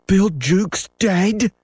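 high, drawn-out startled question; slow, very high disbelief; startled cry. A narrator's reading. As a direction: cry out in shock, pitch leaping. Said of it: "cried the startled pirates".

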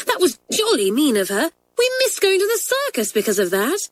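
rising high, jolly indignant schoolgirl. A narrator's reading. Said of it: "cried Hilary indignantly".

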